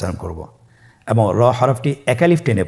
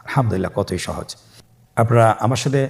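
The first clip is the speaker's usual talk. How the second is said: usual speaking voice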